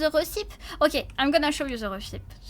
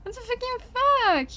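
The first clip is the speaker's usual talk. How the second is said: exasperated outcry; 9 semitones higher than usual. Real, not acted. Streamer whose candy cane recipe won't work, exasperated. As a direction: wail in high-pitched exasperation.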